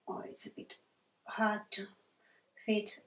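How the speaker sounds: barely voiced aside while busy writing; whispered aside. Real, not acted. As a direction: mutter it to yourself under your breath.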